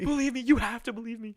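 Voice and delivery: loud, high begging through laughter; mock pleading. Teased boyfriend begging girlfriend, high mock plea. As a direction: beg to be believed, hammed up.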